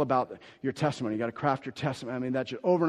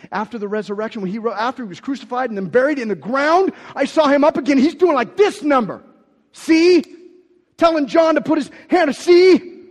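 loud, high, driving preacher voice; preaching at full force; 11 semitones higher, 14 dB louder than usual. Acted. Pastor preaching hard to the church, loud and high. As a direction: preach it out to the congregation.

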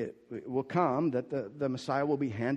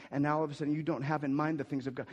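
ordinary delivery

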